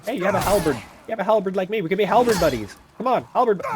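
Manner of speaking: high, loud, eager appeal; playfully over-keen overture; mock friendly pleading. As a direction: call out to a would-be buddy mid-fight. Pleading with an attacking game enemy.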